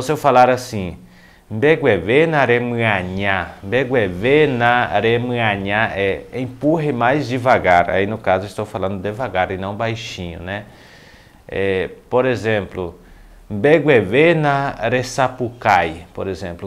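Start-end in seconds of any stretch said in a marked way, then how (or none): 1.5-5.9 slow, carefully spaced words; slow model phrase. Shown as a demonstration. Teacher demonstrating "empurre mais devagar" in Guarani, slowly enunciated. 13.5-16.1 slow, drawn-out, carefully separated words; slow model phrase. Shown as a demonstration. Teacher introducing "grite mais baixo" in Guarani, slowly enunciated.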